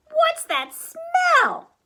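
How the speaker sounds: slow, very high, loud whine; gagging baby bear. A narrator's reading. Baby Bear reels at the perfume, disgusted squeal. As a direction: stretch it out in disgust. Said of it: "Baby Bear gagged".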